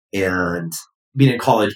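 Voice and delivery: ordinary talk, nothing marked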